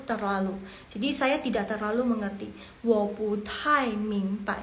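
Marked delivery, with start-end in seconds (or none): 2.7-4.6 slow Mandarin with separated syllables; slow model reading. Shown as a demonstration. Teacher introduces phrase number three, slow model reading. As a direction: sound it out carefully for learners. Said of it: "Next yang nomor tiga".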